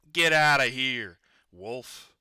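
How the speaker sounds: loud, angry pig yelling back; furious shouted reply; rude shout. Acted. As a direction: blast him away from the door.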